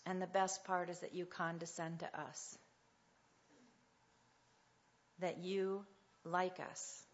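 soft, reverent prayer voice; pastor's gentle closing prayer. Acted. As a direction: pray quietly and unhurried over the room.

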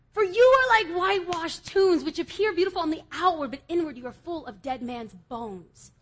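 loud, high proclamation falling back to plain; dramatic scripture reading. Acted. Preacher reciting the whitewashed tombs verse, loud and high. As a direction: declaim the verse then settle.

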